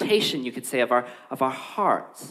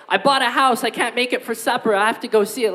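a lightly voiced flimsy excuse, pitched higher; mock excuse; 7 semitones higher than usual. Guest calling his wife to skip supper, lightly mocking. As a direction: toss off the lame excuse.

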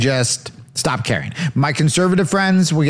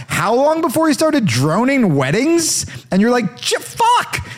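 incredulous high rant; 10 semitones higher than usual. Real, not acted. Comic ranting about Obama droning weddings, very high incredulous. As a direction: pitch shoots way up in disbelief.

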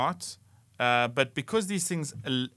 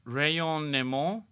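ordinary delivery

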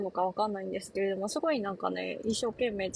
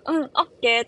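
louder, lightly mimicked foreign learner; ベルギーの男の子の真似; 3 semitones higher, 7 dB louder, faster than usual. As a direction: lightly imitate a boy showing off new words. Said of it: "ベルギーの男の子も". (覚えたての日本語を元気に真似る)

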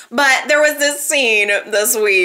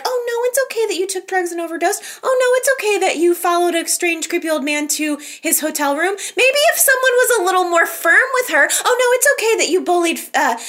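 mock coddling; 7 semitones higher, faster than usual. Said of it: "everybody just rushes to her to be like".

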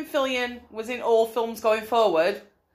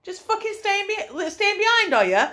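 mock indignant girlfriend; 8 semitones higher than usual. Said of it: "she'd be like".

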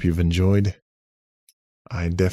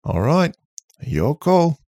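higher, louder robot character; shrugging robot, pitched up; 10 semitones higher, 7 dB louder, slower than usual. A narrator's reading. Robot Charlie replies.

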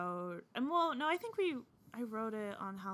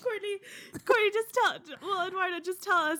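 laughing; 10 semitones higher, 9 dB louder than usual. Real, not acted. Pleading through laughter.

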